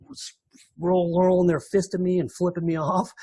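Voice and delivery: ordinary talk, nothing marked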